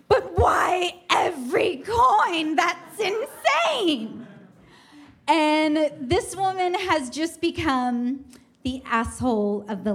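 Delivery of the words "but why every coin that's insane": hammed-up distraught wail, stretched out and rising; mock-tearful, high, slow protest; losing it. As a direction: imitate a traveler cracking up. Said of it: "she's just losing it".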